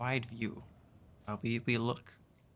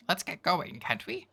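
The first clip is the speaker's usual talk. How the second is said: higher, chirpy sprite pushing to leave; tiny creature voice; 5 semitones higher than usual. Acted. Tiny creature Jan urges the traveler to leave, high. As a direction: nudge them along like a little sprite. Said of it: "I'm gonna stop this voice".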